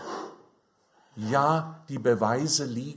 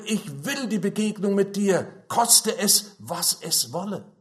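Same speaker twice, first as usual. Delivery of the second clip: raised, higher-pitched resolve; preacher voicing a determined plea; emphatic determination; 7 semitones higher, 3 dB louder than usual. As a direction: declare it insistently, pitched well up. Voices determined seekers of God.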